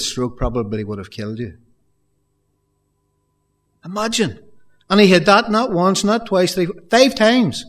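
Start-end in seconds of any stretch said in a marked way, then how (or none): 3.8-7.6 higher and louder, rising to five; building preacher's fervor; emphatic preaching. Real, not acted. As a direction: drive home the count. Preacher stresses beatings.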